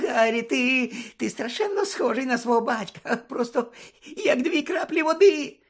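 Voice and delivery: high, whimpering squeak of a cringing coward; timid, high-pitched fawning; ingratiating Pettigrew. Acted. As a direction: plead in a thin, high-pitched voice. Frightened Pettigrew pleading in story.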